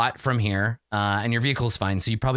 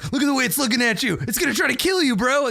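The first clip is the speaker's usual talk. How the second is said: mock terror; 11 semitones higher than usual. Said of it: "are like".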